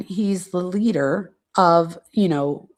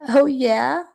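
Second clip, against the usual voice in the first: a mock-excited, childlike "Oh, yeah?" a touch higher; excited childish voice; 5 semitones higher, 5 dB louder than usual. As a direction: imitate her eager little-girl gush. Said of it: "back to her excited childish voice".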